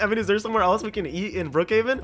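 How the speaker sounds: ordinary talk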